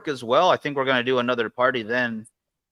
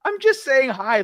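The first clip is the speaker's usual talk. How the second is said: higher, louder innocent mom; lightly voiced mother, higher; mom's voice; 13 semitones higher, 4 dB louder than usual. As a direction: voice the puzzled mom. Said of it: "And she's like".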